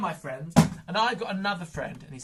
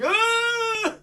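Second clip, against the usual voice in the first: mock shriek; 8 dB louder than usual. Smelly sock revealed.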